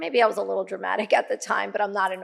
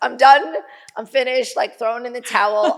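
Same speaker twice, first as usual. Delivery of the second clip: louder, laughing while giving up; laughing surrender; 5 semitones higher, 9 dB louder than usual. Retold or imitated. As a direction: toss in the towel with a laugh.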